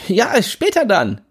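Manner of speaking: cocky friend, pushy and carefree; Jimmy Blue voice. Acted. Jimmy teasing narrator.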